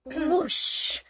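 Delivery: breathy whoosh sound effect; mock snowball whoosh. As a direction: blow out a big whoosh. Sound effect in read story.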